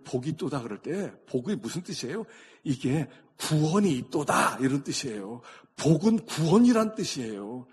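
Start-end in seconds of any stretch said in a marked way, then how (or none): none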